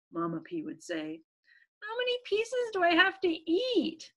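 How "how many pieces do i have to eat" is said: high little-pea voice; complaining child. Acted. Little P asking parents about candy, high child's complaint. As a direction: ask it high, like a reluctant kid.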